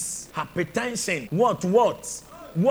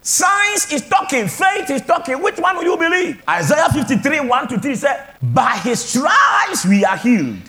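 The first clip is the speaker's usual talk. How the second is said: full-force preaching; 10 semitones higher, 6 dB louder than usual. Real, not acted. Preacher rallying the church against medical doubt, full-force preaching. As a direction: preach it like a battle cry.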